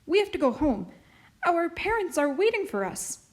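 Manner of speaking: put-on a nervous child eager to leave; anxious girl. A narrator's reading. Marie hurrying home, child voice.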